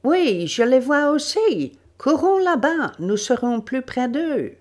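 high, loud call to companions; excited urging. Acted. Webster, revolver in hand, urges the others closer, excitedly. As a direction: urge them on, pitched right up.

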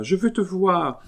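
put-on a small girl calling for her mother; little child calling. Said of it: "criait cette voix". (petite voix d'enfant qui appelle)

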